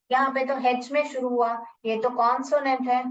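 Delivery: ordinary talk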